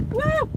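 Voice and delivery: very high little "woo"; startled whoop. Real, not acted. Woman watching dust fly out, high whoop. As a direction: let out a high-pitched whoop.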